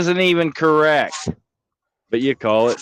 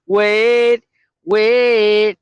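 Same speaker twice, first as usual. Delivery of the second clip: dramatic build; 9 semitones higher, 6 dB louder than usual. Acted. Building up to a song.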